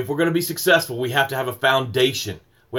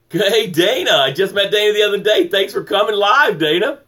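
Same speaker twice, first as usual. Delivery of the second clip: bright, higher, louder hello with a quick pace; pitched up, punchy shout-out to a viewer; excited greeting; 6 semitones higher, 4 dB louder than usual. Real, not acted. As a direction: greet her like a friend you're thrilled to see.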